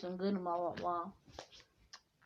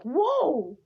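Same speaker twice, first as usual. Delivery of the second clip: loud, high gasp of awe; amazed; 6 semitones higher, 8 dB louder than usual. Acted. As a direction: play someone staggered by sudden power. Acted amazement at the power.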